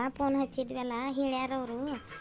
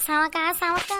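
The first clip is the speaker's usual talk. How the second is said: calling out; 7 semitones higher, 10 dB louder, slower than usual. Acted. Calling a neighbour from outside.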